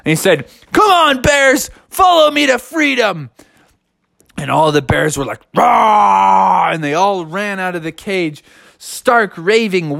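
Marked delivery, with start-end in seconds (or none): 0.7-3.3 high, heroic call to the troops; rally the bears with a high-pitched cry; storybook leader's battle call; rallying shout. Acted. Bear leader rallies the bears. 5.5-6.7 raised, drawn-out mock roar; playful crowd-of-bears roar. Imitates all the bears roaring.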